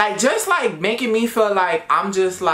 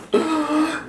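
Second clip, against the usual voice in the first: a sharp, high shocked gasp; 11 semitones higher than usual. Real, not acted. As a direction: gasp in mock horror at the thought. Shocked by own words.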